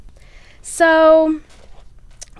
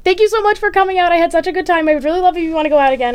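higher, louder, rushed gush of thanks; eager gushing; 7 semitones higher, 10 dB louder, faster than usual. Retold or imitated. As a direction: read his text out in a bright, rushed burst. Quoting his eager text.

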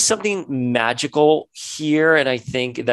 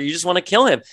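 ordinary delivery

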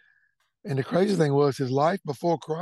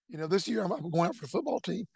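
ordinary delivery

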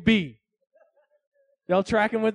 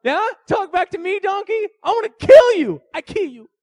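high, loud hammed-up rage; mock furious threat; 10 semitones higher, 10 dB louder than usual. Balaam threatening the talking donkey, mock furious shout. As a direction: threaten him like a cartoon villain.

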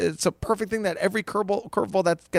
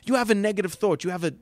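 ordinary delivery

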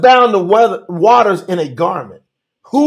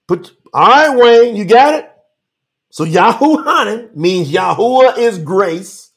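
ordinary talk

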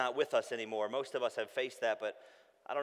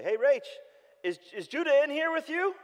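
higher, louder anxious question; worried call; 8 dB louder than usual. Retold or imitated. Father calling to wife, getting anxious.

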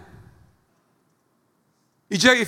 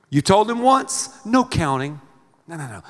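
ordinary delivery

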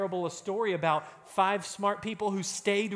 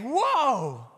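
higher, louder cry of wonder; joyful whoa; 8 semitones higher, 6 dB louder than usual. Acted. Preacher recalling the believers' joyful whoa. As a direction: gasp it out in delight. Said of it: "instead of saying".

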